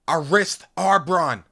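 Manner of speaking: slow, high, loud call of rank; commanding cry. A narrator's reading. Narrator calling comrade to attention, forceful cry. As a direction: cry out to halt a soldier. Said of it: "I cried".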